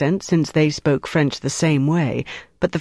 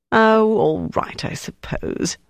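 slow, higher huffy "oh", trailing into a breathy sigh; hint of a flounce; 6 semitones higher, slower than usual. Waiter sulking to Mark about his row, mild flounce. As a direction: sulk a little, then let it drop away. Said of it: "with the hint of a flounce".

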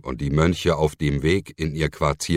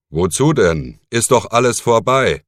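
brisk and louder, rising high at the end; 6 semitones higher, 6 dB louder than usual. Acted. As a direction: brush the worry off briskly. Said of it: "antwortete Berengar forsch". (forsch und laut abwinkend)

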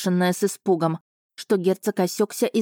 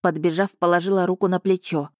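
ordinary delivery

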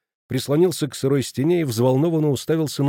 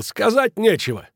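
high, exasperated outburst; angry yell; 9 semitones higher than usual. A narrator's reading. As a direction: snap at the silent group in a raised pitch. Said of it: "заорал Кранер и вскочил". (раздражённый крик)

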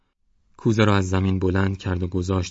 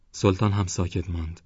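plain reading voice

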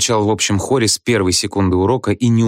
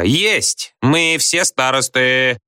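higher, drawn-out gleeful cry; jubilant yell; 5 semitones higher, much slower than usual. A narrator's reading. Back row all claim to be monitors, jubilantly yelling. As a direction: crow it like a rowdy class. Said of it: "ликующе завопила галёрка".

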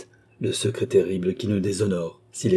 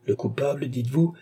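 plain reading voice

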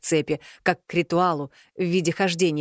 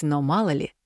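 ordinary delivery

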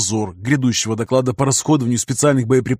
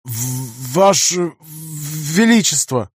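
slow, higher stammering address; 3 semitones higher, much slower than usual. Acted. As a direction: stumble over the words nervously. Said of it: "Адъютант слегка заикался".

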